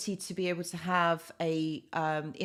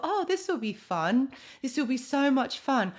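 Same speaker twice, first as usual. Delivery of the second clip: higher-pitched, eager retold thought; mildly excited recollection; 6 semitones higher than usual. Host recalls discovering live streaming, mildly excited. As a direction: re-enact a touch of naive enthusiasm.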